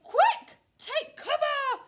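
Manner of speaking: loud, high, drawn-out yell; frantic turkey shout; shouted warning. Acted. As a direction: yell it to scatter everyone.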